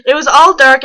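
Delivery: ordinary talk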